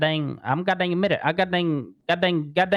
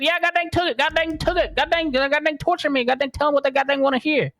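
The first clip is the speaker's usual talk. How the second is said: high, strained imitation of his own pleading; mock panicked confession; 11 semitones higher than usual. Witness acting out how he caved under torture, high and pleading. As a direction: squeak out the confession like you're being tortured. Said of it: "I goddamn said".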